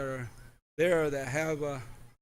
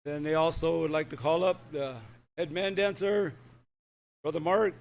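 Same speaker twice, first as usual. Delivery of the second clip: loud, carrying announcer voice; announcer call; 3 dB louder than usual. Acted. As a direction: call it out across the arena. MC calling up head dancer.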